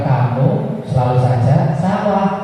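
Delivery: slow, drawn-out singsong; deliberately slow, lilting demonstration of how not to scold; the wrong way. Shown as a demonstration. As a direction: stretch it out slowly with swooping pitch.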